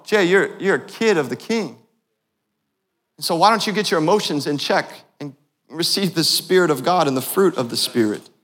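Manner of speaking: ordinary talk, nothing marked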